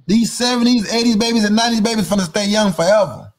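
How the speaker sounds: normal talking voice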